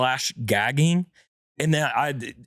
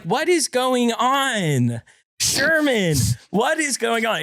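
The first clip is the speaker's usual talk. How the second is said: higher, slow alarmed calls of his name; imitating a frightened wife calling out; wife in a panic over her husband on the floor, calling his name; panicked wife; 9 semitones higher, slower than usual.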